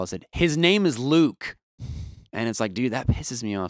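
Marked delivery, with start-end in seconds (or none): 0.3-1.6 slow, high, raised-voice retort; retold yell. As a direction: re-enact yelling a correction, drawn out. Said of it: "And so I, like, yell".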